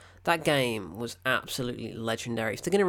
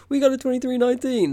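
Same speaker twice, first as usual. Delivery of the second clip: high, loud mock alert; hammed-up panic call; mock alarm call; 11 semitones higher, 8 dB louder, faster than usual. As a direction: shout the alarm. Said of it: "They shave George".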